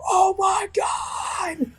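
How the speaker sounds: very high, drawn-out squeal; mock falsetto fan scream. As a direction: imitate friends screaming from the front row, volume held back. Friends screaming in the front row.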